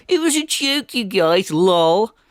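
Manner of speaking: high, silly put-on voice; mocking excuse-maker. People dodging blame after a nasty act, mockingly silly. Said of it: "and then go".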